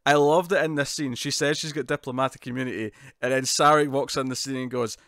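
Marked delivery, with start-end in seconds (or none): none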